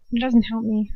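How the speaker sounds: usual speaking voice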